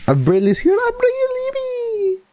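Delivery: high, stretched call of a name; excited greeting. Real, not acted. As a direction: call out to a friend joining the room, with delight. Greeting a newcomer with calls.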